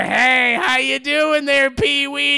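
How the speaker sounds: loud, high cowboy hello; Cowboy Curtis greeting Pee-wee, loud; hammed-up cowboy. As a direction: boom out a hammy greeting.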